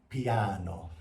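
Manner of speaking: low, quiet demonstration; piano, soft. Shown as a demonstration. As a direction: say it softly to model quietness. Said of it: "soft or quiet".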